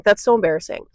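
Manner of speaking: ordinary talk, nothing marked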